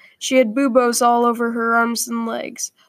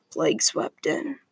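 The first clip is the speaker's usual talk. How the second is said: low, soft and weak; a trapped boy barely getting the words out, lower and softer; weakly; 7 semitones lower, 6 dB quieter than usual. Acted. Trapped boy answering the friar, low and weak. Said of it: "he replied weakly".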